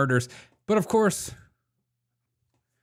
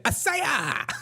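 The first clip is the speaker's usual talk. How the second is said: higher hammed-up martial-arts cry; mock karate yell; 11 semitones higher than usual. Vlogger imagining the suspect fighting back, with a higher hammed-up karate yell. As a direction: yell it like a cartoon kung-fu fighter, mocking. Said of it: "What if he said".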